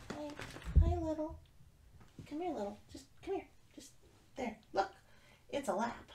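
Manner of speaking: high, coaxing call to the cat; coaxing pet voice. Real, not acted. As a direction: lure the kitty over sweetly.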